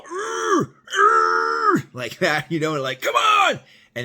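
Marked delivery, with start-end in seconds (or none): 0.0-1.9 imitated raging screech, loud and high; high strained enraged shriek. Inmate holding broken glass at the cops, raging shrieks. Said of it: "he's making these, uh, sounds". 3.0-3.6 loud, high screamed challenge; enraged yelling. Retold or imitated. Inmate challenging the cops at the gate, screaming. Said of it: "And he's all".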